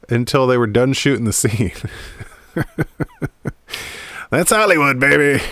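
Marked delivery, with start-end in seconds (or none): 2.5-4.2 airy unvoiced laugh; short airy laugh; breathy laugh. Real, not acted. Laughs at Hollywood absurdity. 4.3-5.4 high, loud mock showman; loud, high "that's Hollywood, baby"; showbiz exclaim.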